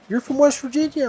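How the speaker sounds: high-pitched sergeant's question; imitated drill sergeant. Head drill sergeant questions recruit about his home, imitated high-pitched. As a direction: ask it as the sergeant, pitched well up. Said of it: "and he's like".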